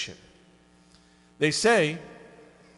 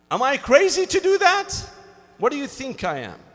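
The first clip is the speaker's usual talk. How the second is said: much higher, loud disbelieving cry; incredulous outburst; 9 semitones higher, 7 dB louder, faster than usual. Acted. Climber protesting God's order to cut rope, shocked disbelief. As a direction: balk at the absurd order.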